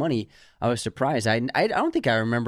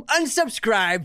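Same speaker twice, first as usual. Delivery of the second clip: mock dramatic sign-off; 10 semitones higher, much slower than usual. Ending hater comment.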